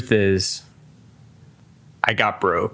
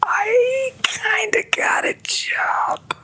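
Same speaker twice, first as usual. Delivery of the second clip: sheepish whisper; 4 dB quieter, much slower than usual. Acted.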